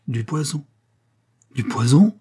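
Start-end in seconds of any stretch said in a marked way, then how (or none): 1.6-2.2 loud question with rising end; shocked exclamation. A narrator's reading. Duke recoils from the flask, exclaiming in shock. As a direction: echo it aloud, recoiling in alarm. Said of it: "s'écria le duc en reculant".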